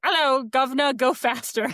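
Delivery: a chirpy Cockney coaxing her to speed up; Cockney accent. Retold or imitated. Her inner central governor urging her on in mock Cockney. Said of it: "It has, like, a Cockney accent".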